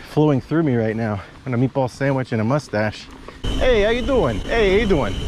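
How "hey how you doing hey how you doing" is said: lightly voiced Italian-American hello, pitched up; a hint of a cheerful New York Italian greeter; Mister Bonelli greeting. Mountain biker channeling Mister Bonelli greets riders, lightly Italian-American. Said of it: "Like the ghost of Mister Bonelli is".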